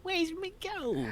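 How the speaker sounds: very high, slow leprechaun squeal; shrill leprechaun taunt, drawn out; squeaky leprechaun. Retold or imitated. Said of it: "But not".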